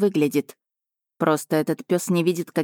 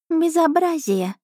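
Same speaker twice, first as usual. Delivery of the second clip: high, slow contemptuous cat voice; scornful cat familiar; 11 semitones higher, slower than usual. Acted. Cat familiar scorning the duke, high contemptuous voice. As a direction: sneer like a royal cat.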